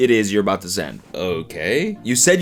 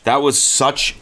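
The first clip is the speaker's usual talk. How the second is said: ordinary talk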